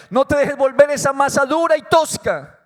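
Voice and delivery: usual speaking voice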